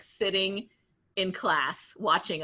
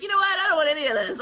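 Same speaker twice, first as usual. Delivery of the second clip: defiant outburst; 10 semitones higher, 8 dB louder, faster than usual. Retold or imitated. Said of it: "I was like".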